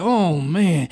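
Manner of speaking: normal talking voice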